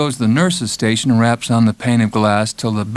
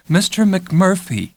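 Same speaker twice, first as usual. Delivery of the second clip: slow, measured, stress on Mister; a nurse scolding a patient, calm and slow; calm schoolteacher tone; 7 semitones higher, slower than usual. A narrator's reading. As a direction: let the name hang like a teacher's warning. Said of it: "calm schoolteacher tone".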